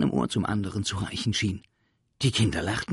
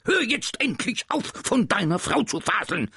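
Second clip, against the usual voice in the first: much higher and louder, a furious command; angry shout; 14 semitones higher, 5 dB louder than usual. Acted. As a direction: yell at the pupil to stop. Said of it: "schrie Herr Groll".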